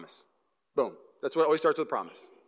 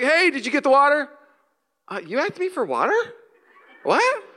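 mock surprise; 9 semitones higher than usual. Husband and wife confused over forgotten water, high baffled voice. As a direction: act confused about the water. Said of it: "I was like".

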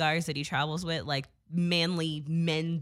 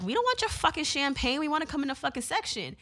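mock-bratty girl voice pitched way up; snotty angry girls; 9 semitones higher than usual. Girls refused entry to the VIP section, high indignant whine. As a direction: play the entitled club girls throwing a fit.

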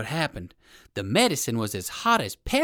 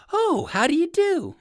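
polite rabbit greeting; 4 dB louder than usual. Acted. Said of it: "spoke Uncle Wiggily".

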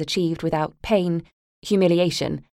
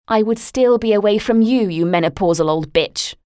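loud, higher venomous outburst; 4 semitones higher, 5 dB louder than usual. Acted. Daughter lashing out at her mother with venom. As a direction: spit the insult at her. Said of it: "taken aback by my venom".

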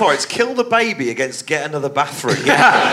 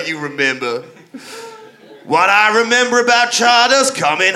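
Southern lawyer. Acted. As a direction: play a folksy Southern defence attorney addressing the jury. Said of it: "a defence lawyer from the deep south of America".

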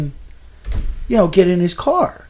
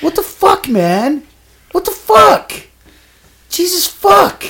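much higher, louder exasperated cursing; high incredulous swearing; exasperated outburst; 9 semitones higher, 3 dB louder, slower than usual. Retold or imitated. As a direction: throw your hands up in disbelief. Teller exasperated at the nephew.

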